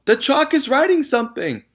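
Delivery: very high, loud panicked shriek. A narrator's reading. Nigel shrieking to the class about the chalk. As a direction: scream it at the top of your voice. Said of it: "screamed Nigel".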